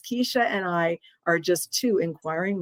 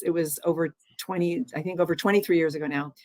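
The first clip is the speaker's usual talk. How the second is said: ordinary delivery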